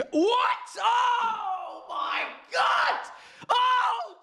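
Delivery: very high shouted shock; shrieking disbelief. Real, not acted. Fan reads Sancho in the lineup, shrieks in shock. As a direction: scream it in disbelief. Sees Sancho starts, screams.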